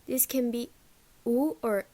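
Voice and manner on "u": "u" as a fronted oo; fronted goose vowel. Shown as a demonstration. Teacher showing the goose vowel, fronted. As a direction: say "u" as oo made forward in the mouth. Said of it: "pronounced very far in the front".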